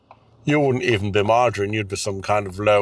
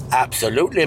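normal talking voice

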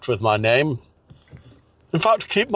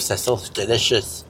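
normal talking voice

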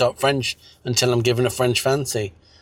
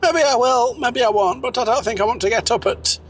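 high character voice; 9 semitones higher than usual. Acted.

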